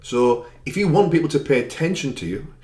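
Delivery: normal talking voice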